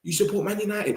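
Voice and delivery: high, soft mocking voice; mocking other fans. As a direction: tease him like a smug rival fan. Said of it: "When they hear that you're a Londoner".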